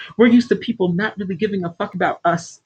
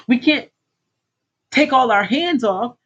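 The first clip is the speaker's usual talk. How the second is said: ordinary delivery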